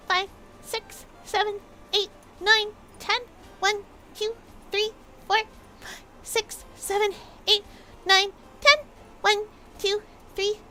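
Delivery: high, punchy, evenly spaced count; counting exercise reps. Real, not acted. Streamer counting butt kicks for chat, high rhythmic count.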